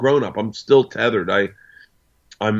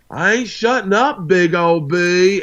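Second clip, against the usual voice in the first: daughter's high, drawling put-on accent; 9 semitones higher, slower than usual. Father imitating his defiant daughter's put-on accent, high stretched holler. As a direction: holler back high in a drawn-out mock accent. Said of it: "she's doing this accent".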